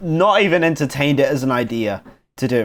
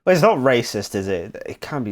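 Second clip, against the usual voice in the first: ordinary delivery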